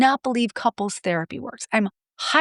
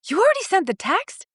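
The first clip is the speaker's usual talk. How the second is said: mock surprise; 9 semitones higher than usual. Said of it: "and he'll go".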